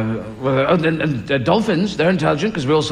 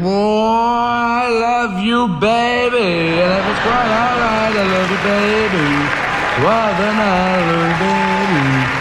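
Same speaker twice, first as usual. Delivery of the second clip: slowed-down whale DJ; 2 semitones higher, slower than usual. Acted. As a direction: croon it like a record played too slow. Whales as DJs on slowed record.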